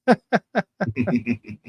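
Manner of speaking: long low chuckle; real laugh. Real, not acted. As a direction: laugh it out at your own joke. Laughs at own party joke.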